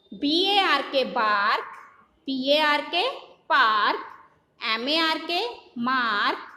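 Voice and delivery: slow spelling chant, each word stretched; phonic drill. Shown as a demonstration. Teacher drilling children in phonics, measured chant. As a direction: spell it out in a measured sing-song, last word falling.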